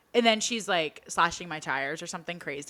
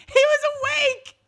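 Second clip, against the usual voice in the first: high laughing burst of disbelief; squealing laugh at the reveal; 7 dB louder than usual. Real, not acted. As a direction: laugh it out, shocked.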